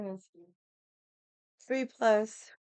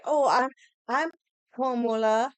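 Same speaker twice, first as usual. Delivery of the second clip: higher, louder mock of her claim; mocking imitation; 5 semitones higher, 5 dB louder than usual. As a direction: parody her proud introduction. Imitating candidate claiming Hawaiian name.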